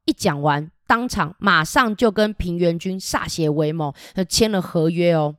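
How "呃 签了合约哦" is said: plain reading voice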